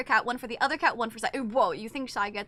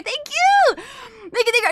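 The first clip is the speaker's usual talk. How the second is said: high, loud burst of thanks; excited thanks; 9 semitones higher, 6 dB louder than usual. Real, not acted. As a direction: squeal the thanks out. Thanking subscriber.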